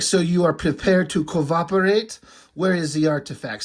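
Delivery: comic-book German woman; German accent. Acted. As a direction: interrogate in a put-on German accent. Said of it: "maybe a stereotypical German accent".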